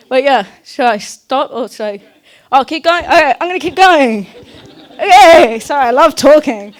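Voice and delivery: high, loud and laughing; giddy apology. Real, not acted. Speaker laughing at herself on stage for running over time, high and loud. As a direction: burst out laughing at yourself, then rush on.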